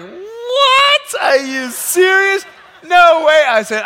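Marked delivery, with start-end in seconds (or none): 0.0-2.4 high, loud, astonished cry; shocked passengers. Retold or imitated. Passengers seeing the pilot, shocked shout. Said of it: "And they're like". 2.8-3.4 disbelief. Retold or imitated. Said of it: "And they're like".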